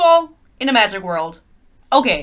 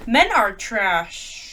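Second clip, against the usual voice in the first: slow deadpan; much slower than usual. Real, not acted. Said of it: "Sorry, guys".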